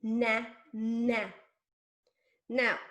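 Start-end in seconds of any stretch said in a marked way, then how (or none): 0.0-1.2 NA... NA, two drawn-out beats; separated syllables. Shown as a demonstration. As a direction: leave a gap between NA and NA. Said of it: "what do I have left?".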